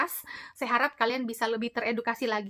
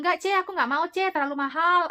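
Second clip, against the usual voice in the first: a higher, louder mock protest from the client; imitating a client's refusal; 6 semitones higher, 5 dB louder than usual. Client refusing costly tip surgery, mock higher and louder. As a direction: whine it back like the stingy client.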